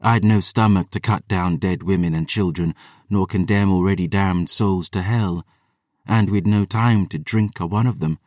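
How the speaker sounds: plain reading voice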